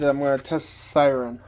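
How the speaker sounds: ordinary delivery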